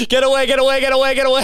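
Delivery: high, whiny get away, get away; mock panicked squeal. Shooing away any girl who comes near, high panicked squeal. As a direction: shriek it like a panicked child. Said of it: "put my hands into my ears and go".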